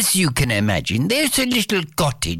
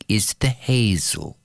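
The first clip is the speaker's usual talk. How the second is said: plain reading voice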